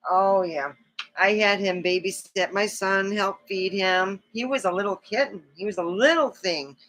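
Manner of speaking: normal talking voice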